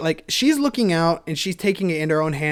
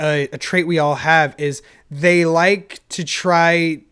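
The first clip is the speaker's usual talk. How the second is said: ordinary talk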